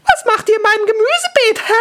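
very high, loud squeak; piercing little-girl demand; indignant cartoon girl. Garden girl confronts the adventurers.

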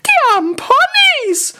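very high, loud, slow cry; piercing manga-girl wail; calling cartoon girl. A narrator's reading. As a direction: squeal it out in dismay. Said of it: "rief währenddessen das gelbe Mädchen".

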